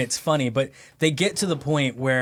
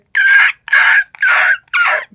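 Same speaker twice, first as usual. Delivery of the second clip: very high, loud pterodactyl squawks; imitated screeching laughter in repeated loud bursts; high-pitched shrieking; 11 dB louder than usual. Manager dying laughing over the speaker, imitated high shrieks. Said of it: "that, like, high pitch".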